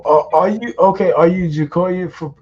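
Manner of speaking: usual speaking voice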